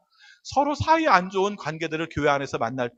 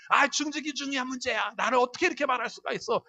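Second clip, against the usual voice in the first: high, softer acted grievance; aggrieved, high-pitched retort; mock indignation; 9 semitones higher, 5 dB quieter than usual. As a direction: play someone offended and protesting. Acted offended church member.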